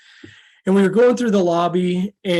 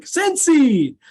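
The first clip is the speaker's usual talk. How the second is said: calling out; 9 semitones higher than usual. Retold or imitated. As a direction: call out Scentsy to a stranger in line. Breaking the ice, calling out.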